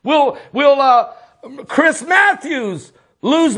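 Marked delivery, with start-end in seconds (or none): none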